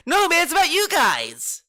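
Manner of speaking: high, loud sarcastic mock quote; mocking whine. Streamer parrots the guest's deflection to chat, high and loud. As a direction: parrot his line back high and loud to ridicule it.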